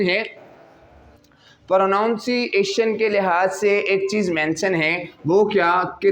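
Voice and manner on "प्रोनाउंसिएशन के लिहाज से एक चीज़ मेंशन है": ordinary talk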